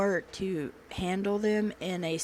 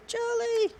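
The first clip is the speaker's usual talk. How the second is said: excited call. Real, not acted. Calling name again.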